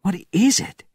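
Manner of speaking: high-pitched, startled question; excited cry rising sharply in pitch; urgent question. A narrator's reading. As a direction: ask it high with sudden alarm. Alarmed Soviet sees distant fire.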